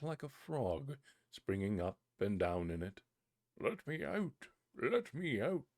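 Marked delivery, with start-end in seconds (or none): 3.6-5.7 high, small pleading cry; tiny spirit crying. Acted. Spirit in the bottle crying to the boy, high-pitched. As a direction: beg to be freed from the bottle. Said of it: "it cried anew".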